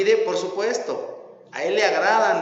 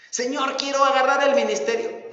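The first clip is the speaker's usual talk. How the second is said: whining plea; 8 semitones higher, 4 dB louder than usual. Retold or imitated. Sinner begging God for ministry.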